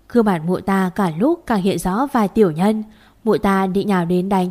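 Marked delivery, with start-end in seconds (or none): none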